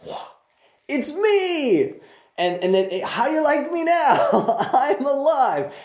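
high, boastful mock reveal; laughing hammed-up victory cry; triumphant Jesus. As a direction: show off like a champion. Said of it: "He'd be like".

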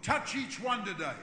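loud, rising preacher's prayer. Acted. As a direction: pray it over the people with full force. Leads closing prayer.

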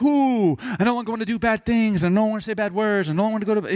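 mock instantly holy convert. Pastor mocking the instant change of a new believer, gushing. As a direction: gush like someone zapped holy overnight.

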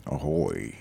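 low pirate growl. Pretend. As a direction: play a lightly voiced pirate. Pirate echo.

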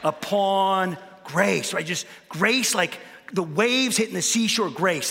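slow, swelling preacher's emphasis; preaching with rolling waves of grace. Real, not acted. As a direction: stretch out grace like a sermon. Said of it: "so I might be preaching".

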